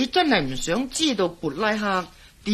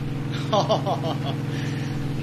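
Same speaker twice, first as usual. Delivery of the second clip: a short, airy chuckle falling away; breathy chuckle; 2 semitones lower than usual. Acted. Jack chuckling at Wesley's teasing. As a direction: laugh it off, amused.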